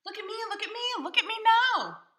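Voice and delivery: very high, loud boastful cat; showing off. Acted. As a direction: crow for attention like a show-off. Cat shows off again.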